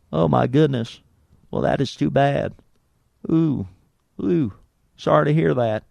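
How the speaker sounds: put-on presidential sympathy, spaced out; Bill Clinton. Fake Clinton sympathizes with patient on phone, put-on concerned voice. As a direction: commiserate in short cooing bits with pauses. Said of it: "call the hospital as Bill Clinton".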